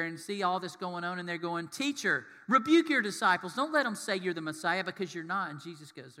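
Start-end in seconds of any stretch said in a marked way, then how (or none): 1.7-5.5 raised, louder mock scolding; indignant rebuke. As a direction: act out an outraged demand. Religious leaders scolding Jesus, acted.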